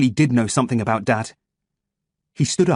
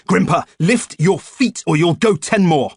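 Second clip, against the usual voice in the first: loud, higher commanding shout; drill-sergeant holler; barked order; 6 semitones higher, 5 dB louder than usual. A narrator's reading. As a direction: yell the order across the room.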